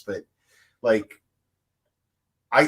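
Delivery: usual speaking voice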